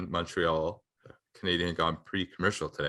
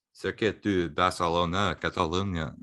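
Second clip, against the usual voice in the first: put-on slow French-Spanish accent; European flair. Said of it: "that'd be my European flair".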